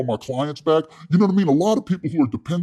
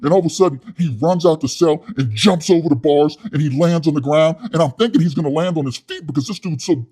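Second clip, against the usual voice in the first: ordinary talk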